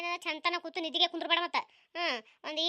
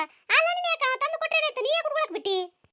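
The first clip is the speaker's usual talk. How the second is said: put-on high, loud indignant old woman; shrill village auntie, taking offence; indignant neighbour; 9 semitones higher, 3 dB louder than usual. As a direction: demand why she allowed it.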